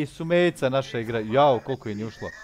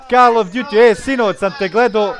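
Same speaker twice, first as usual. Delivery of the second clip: excited shout; 6 semitones higher, 8 dB louder than usual. Real, not acted. Streamer excitedly greets viewer.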